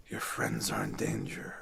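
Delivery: urgent hushed warning; whispering old lady. Acted. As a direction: whisper the danger to them.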